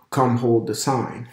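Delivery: ordinary talk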